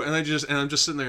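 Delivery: ordinary talk, nothing marked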